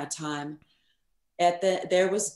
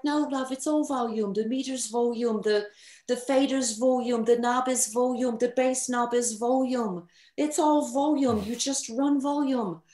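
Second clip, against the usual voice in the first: put-on British accent; 6 semitones higher than usual. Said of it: "I'll try to do the accent".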